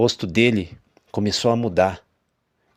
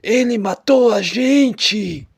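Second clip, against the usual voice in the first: high, slow, ghostly wail; eerie drawn-out ghost voice; ghostly dead woman; 12 semitones higher, 5 dB louder, much slower than usual. A narrator's reading. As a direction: moan it like a corpse speaking. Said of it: "e falou".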